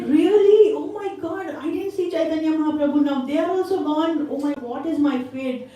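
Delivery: high-pitched shocked lament; acted dismay, voice lifted high. Devotee to the Brahmin on learning they died, dismayed.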